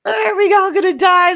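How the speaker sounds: high, loud, mock-crying wail; mock panic. Mock panic about turkey.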